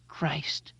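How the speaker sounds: hoarse, hushed exclamation. Acted. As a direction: rasp it out under the breath. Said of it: "Mike said hoarsely".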